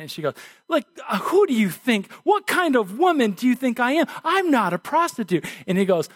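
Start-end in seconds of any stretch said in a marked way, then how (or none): 0.7-5.5 higher-pitched mock outrage; indignant. Insulted woman answering Churchill's offer, indignant. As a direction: act out an affronted woman protesting. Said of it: "All indignant".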